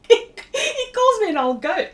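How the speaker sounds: high, slow, laughing repeat; laughing disbelief. Real, not acted. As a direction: say it again, cracking up in real disbelief. Retelling colleague's teasing, laughing.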